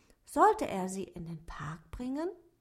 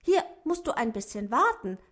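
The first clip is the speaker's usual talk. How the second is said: put-on boy calling out louder to the duck; a boy's eager shout into the garage; 2 semitones higher, 7 dB louder than usual. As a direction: call it out so the duck hears. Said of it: "rief Simon".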